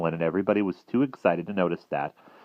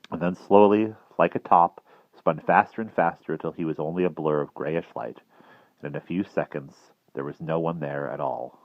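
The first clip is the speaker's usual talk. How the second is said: ordinary delivery, nothing marked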